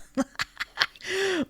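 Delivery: high croaking cackle; toad folk cackle. Acted. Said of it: "and goes".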